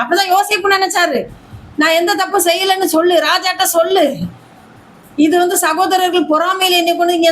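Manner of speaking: high, driving pulpit proclamation; fervent preaching. Acted. Preacher promising the congregation God will raise them, fervent preaching. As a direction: lift the crowd with rising promise.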